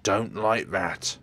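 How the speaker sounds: loud, each word drawn out; slow desperate shout. Acted. Begging Jimmy not to light the gas, shouted. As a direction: plead at full volume.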